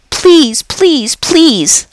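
squeaky pleading. Acted. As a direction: beg like an eager little hamster. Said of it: "I squeaked".

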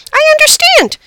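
very high, loud little squeak; squeaky hamster. A narrator's reading. Hamster answers the girl's secret, high squeaky voice. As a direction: squeak it like a tiny animal. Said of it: "I squeaked".

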